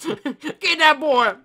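high, loud, dragged-out holler; hammed-up mob yell; angry mob member. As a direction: holler slowly like a pretend posse. Plays mob chasing the imposter.

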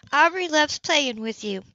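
an easygoing neighbour offering help; Grace, friendly. Acted. Narrator voices Grace.

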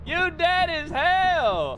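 high, drawn-out triumphant yell; taunting a fallen teammate; gloating shout. Real, not acted. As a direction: crow it slowly and high. Gloating at a dead teammate in game.

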